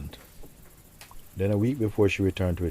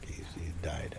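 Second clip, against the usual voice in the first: whispered news; 2 semitones lower, 13 dB quieter than usual. Retold or imitated. Relatives announcing father's death.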